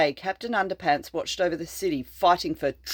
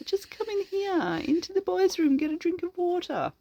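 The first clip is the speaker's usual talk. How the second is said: much higher, sing-song offer; a sly, sugary invitation; sweetly tricking; 12 semitones higher, 4 dB quieter than usual. Acted. The hero luring the monster, sugary high voice.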